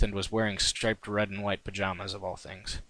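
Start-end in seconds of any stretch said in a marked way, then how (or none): none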